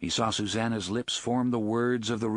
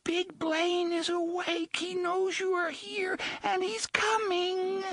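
put-on high, slow, trembling child's cry; frightened child warning; slower than usual. A narrator's reading. Little child warning that Blaine is coming. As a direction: warn them like a terrified little kid.

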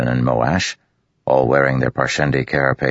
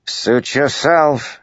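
dismissive call; 13 semitones higher, much slower than usual. A narrator's reading. Matal calling back while leaving, slow dismissive. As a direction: brush them off as you go. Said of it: "he called".

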